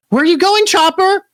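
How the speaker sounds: very high, rising cry; alarmed question. Acted. Crew asking Chopper where he's going, alarmed. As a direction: call after someone in cartoon alarm.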